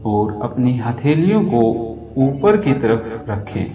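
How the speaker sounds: soothing yoga nidra guidance; guided meditation. Acted. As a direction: talk them gently down into rest. Leads a yoga nidra relaxation.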